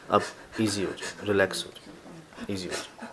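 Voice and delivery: soft, hushed reassurance; calm them down gently, fading almost to a whisper; soothing. Real, not acted. Interviewer calms a crying woman.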